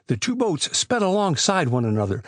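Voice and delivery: usual speaking voice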